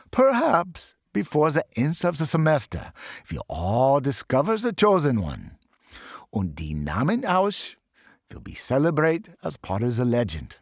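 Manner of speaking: put-on professor's mild German accent, hopeful. Professor tells the students of the chosen one, German accent. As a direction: promise a legend, with German lilt. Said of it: "bad accent".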